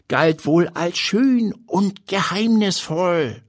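put-on high, slowing old-man voice; lecturing old grandpa. A narrator's reading. Grandpa explaining belladonna's history, high lecturing old-man voice. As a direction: explain it as a didactic grandpa, pitched well up.

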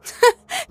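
short, high scoffing laugh; incredulous laugh. A narrator's reading. Narrator hearing friend's excuse, incredulous laugh. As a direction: burst out in a disbelieving laugh. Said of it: "Ich lachte ungläubig auf".

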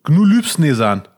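"Gnu Lübsnesan" said slowly as Nasenspülung reversed; backwards speech. Shown as a demonstration. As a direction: sound out "Gnu Lübsnesan" letter by letter, slow. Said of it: "rückwärts sprechen".

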